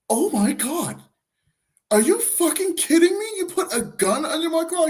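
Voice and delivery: high-pitched mock indignation; hammed-up incredulous lady voice; imitating outraged woman. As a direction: act out her shocked outrage, way up high. Retold outrage of woman at gun.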